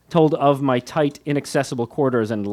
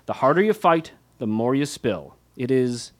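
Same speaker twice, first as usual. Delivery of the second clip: ordinary delivery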